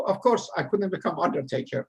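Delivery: usual speaking voice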